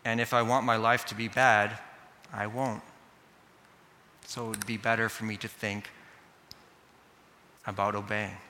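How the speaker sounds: lightly voiced inner reasoning, sinking soft and low; child's reflective resolve. Kid weighing a bad life against obeying, quietly deliberate. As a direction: let each thought settle before the next. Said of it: "think in this way or something like this".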